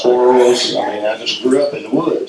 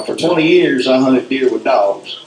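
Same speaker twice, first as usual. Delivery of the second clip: normal talking voice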